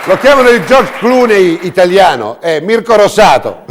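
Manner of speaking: loud, high, booming presenter; showy game show host. Acted. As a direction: hype up the crowd like a TV host. Host introduces the guests.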